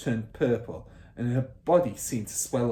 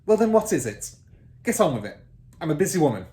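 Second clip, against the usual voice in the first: loud, higher, clipped headmistress bark; impatient bark; 6 semitones higher, 8 dB louder, much faster than usual. Acted. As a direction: snap at her to hurry up. Trunchbull voiced.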